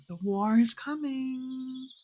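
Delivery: high, drawn-out mock prophecy; mock-ominous warning. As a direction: stretch it out like a joking doom warning. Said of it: "I shouldn't joke about it".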